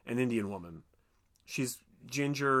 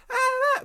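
high squeal; 6 dB louder than usual. Retold or imitated. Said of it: "she's like".

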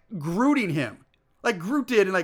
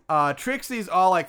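ordinary talk

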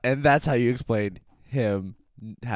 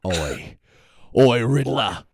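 loud, belligerent British Batman; fake British accent; 6 dB louder than usual. Batman confronts the Riddler, belligerent fake British accent. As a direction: challenge him in a mock British accent. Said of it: "fake accent that you're doing".

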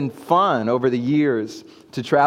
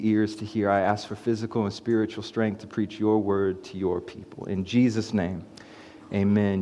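prayer; 4 semitones lower, 6 dB quieter than usual. Acted. Opening prayer before sermon.

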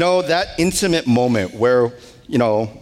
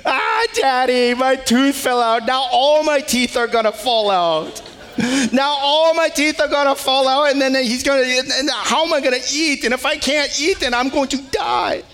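put-on panicked little boy; 8 semitones higher than usual. Five-year-old wailing to Daddy about his lost tooth, high panicky whine. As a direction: play a five-year-old sure he's doomed. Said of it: "his mind goes to doomsday".

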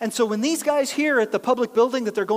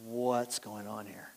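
hushed, slow, softer wonder; amazed; 11 semitones lower, 11 dB quieter, slower than usual. Retold or imitated. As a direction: breathe it out in disbelief. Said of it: "they're like".

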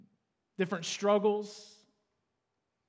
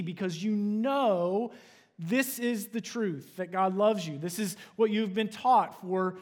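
higher, louder impassioned preaching; 4 semitones higher, 3 dB louder than usual. Acted. Pastor urging the congregation that God loves them, fervent preaching. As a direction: drive the point home to the church.

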